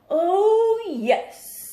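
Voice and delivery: very loud, high boast; boastful dragon. Acted. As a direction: show off proudly.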